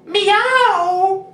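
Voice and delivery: high, loud meow; cat meow. Pretend. The cat answers the child with a high, loud meow.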